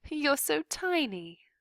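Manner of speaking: high, cooing princess. A narrator's reading. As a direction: dote on the little mouse. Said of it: "said the princess to Despero".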